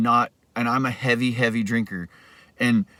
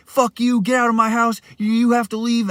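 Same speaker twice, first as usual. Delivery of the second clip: much higher, angry yell; 9 semitones higher than usual. Retold or imitated. Roommate ordering him out of the house, angry yell. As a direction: imitate the roommate screaming. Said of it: "he's yelling at me".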